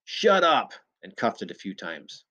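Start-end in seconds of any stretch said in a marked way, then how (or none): 0.0-0.7 high, loud command; angry drunken yell. A narrator's reading. Drunk silencing the barking shepherd, yelling. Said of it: "one of the drunks yelled".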